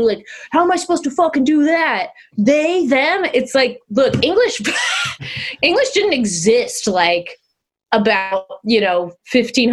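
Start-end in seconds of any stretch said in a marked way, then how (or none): 0.5-3.2 high-pitched mock bewilderment; mock outrage. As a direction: imitate someone making a fuss over nothing. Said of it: "acting like this is some sort of explosive new".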